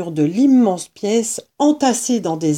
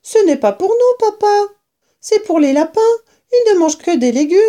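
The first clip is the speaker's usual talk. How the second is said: put-on much higher, child cub voice; little fox cub; 11 semitones higher, 4 dB louder, faster than usual. A narrator's reading. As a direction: pipe up like the smallest cub. Cub explaining to father.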